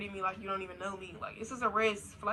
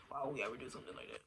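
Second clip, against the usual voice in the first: imitated guy's low, quick denial; mock denial; 7 semitones lower, 10 dB quieter, much faster than usual. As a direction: brush it off low, soft and fast. Imitates guy denying it.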